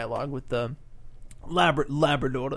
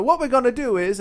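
higher British host explaining a segment; James Corden; 7 semitones higher, 4 dB louder than usual. Acted. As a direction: put on a British presenter's accent. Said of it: "in his British accent".

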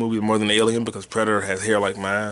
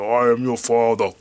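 Darth Vader; 2 semitones lower than usual. Acted. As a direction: do the famous masked villain. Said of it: "uh, Darth Vader".

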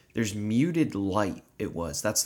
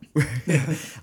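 a quick, higher burst of laughter at himself; short laugh; 6 semitones higher than usual. Real, not acted. Laughing at saying no.

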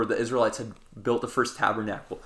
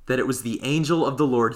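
ordinary talk

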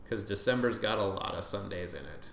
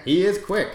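louder, higher "is" stressed; emphatic IS; 8 semitones higher, 8 dB louder than usual. Real, not acted. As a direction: insist that he IS quick. Emphatic agreement about Rob.

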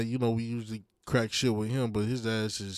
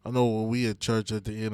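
usual speaking voice

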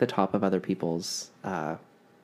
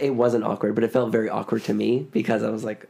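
ordinary talk, nothing marked

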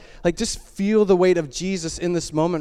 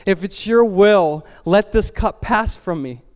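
raised, slow plea; emotional stress; 4 semitones higher, 4 dB louder, slower than usual. Acted. Jesus praying to the Father, under emotional stress. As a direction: plead earnestly, higher and drawn out. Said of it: "he's in great emotional stress".

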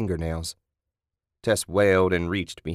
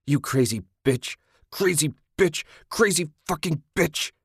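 climbing ever higher scream, building; 8 semitones higher than usual. A narrator's reading. Ted beating Kathy, rising frenzied scream. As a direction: chant it higher with each blow. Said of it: "he screamed".

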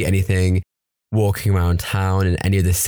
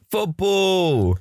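excited local; 12 semitones higher than usual. Retold or imitated. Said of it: "And they're like".

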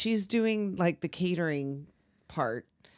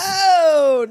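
mock death cry; 10 semitones higher, 10 dB louder than usual. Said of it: "pretends to die".

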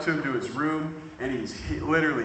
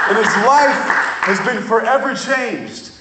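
high, loud, fervent preaching; preaching at full force; 10 semitones higher, 11 dB louder than usual. Acted. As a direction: carry the room with rising fervour.

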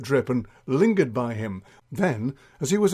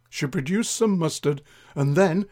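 ordinary delivery

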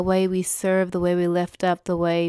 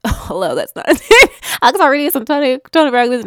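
louder, higher, giggling through the words; laughing at herself; 6 semitones higher, 9 dB louder, faster than usual. Real, not acted. Speaker catching own name mistake, laughing. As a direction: laugh off the slip.

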